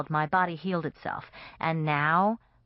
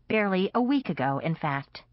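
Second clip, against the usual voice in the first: plain reading voice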